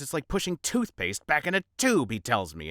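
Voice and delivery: usual speaking voice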